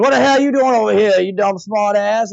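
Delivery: high, slurred New Orleans native; New Orleans accent. Retold or imitated. New Orleans tattoo parlor guy ribbing customers, thick local accent. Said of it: "I pretty much am mimicking this guy's voice".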